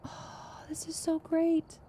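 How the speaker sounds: soft, high, slow gush; awed delight. Real, not acted. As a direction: breathe it out in high-pitched wonder. Overwhelmed by the trophies.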